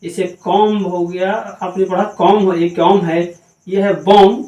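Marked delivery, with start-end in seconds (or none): none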